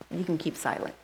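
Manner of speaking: ordinary talk, nothing marked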